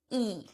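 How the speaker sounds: long E with lips stretched wide, quiet; wide spread E. Shown as a demonstration. Teacher shows students a stretched, quiet E. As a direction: stretch the mouth and hold E. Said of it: "like you're forcing your mouth open".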